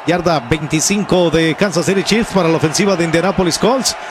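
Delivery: building from loud call to high shout; excited play-by-play. Real, not acted. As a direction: climb with the run to the end zone. Narra en vivo la jugada. (narración que crece hasta el grito)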